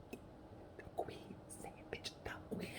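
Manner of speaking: urgent whisper. Acted. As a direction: hiss it secretly. Whispered prompt to Meghan.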